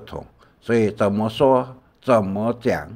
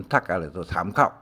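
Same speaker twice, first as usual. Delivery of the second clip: usual speaking voice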